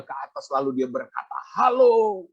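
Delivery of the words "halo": haughty, high and loud hello; arrogant. Retold or imitated. Arrogant policeman answering the phone, haughty hello. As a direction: answer the phone like a self-important officer. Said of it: "dengan sombongnya".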